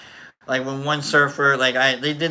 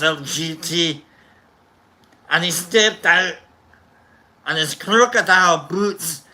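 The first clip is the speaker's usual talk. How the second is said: measured, hammed-up Scottish storyteller; mock-steamy reading with a Scottish accent. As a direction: read it aloud in a put-on Scottish voice. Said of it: "in, like, the Scottish voice".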